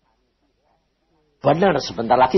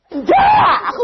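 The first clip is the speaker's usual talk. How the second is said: high, loud shouted no; loud denial; 7 dB louder than usual. Retold or imitated. Denies the rumor loudly.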